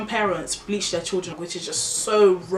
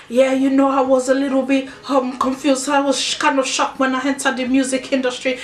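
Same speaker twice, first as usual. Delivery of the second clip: imitating an African star's put-on foreign accent, louder; mock put-on foreign accent; 5 semitones higher, 3 dB louder than usual. Mocking an artist who drops his Nigerian accent, put-on foreign accent. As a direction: parody him faking a foreign accent. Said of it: "why are you talking like that?".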